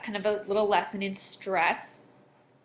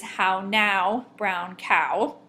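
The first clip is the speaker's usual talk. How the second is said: "how now, brown cow" with heavy, louder beats; over-exaggerated stress; 4 dB louder, much slower than usual. Shown as a demonstration. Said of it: "I will over-exaggerate it".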